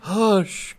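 high, loud, fearful hushing; alarmed cry. A narrator's reading. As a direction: cry out in alarm to silence them. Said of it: "cried Lancelot, trembling".

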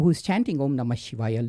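usual speaking voice

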